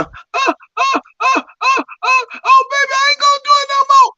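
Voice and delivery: very high falsetto moans into a rushed cry; mock ecstatic moans. Man imitating climax while explaining his slang, mock ecstatic falsetto. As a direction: squeal it out in hammed-up ecstasy, very high.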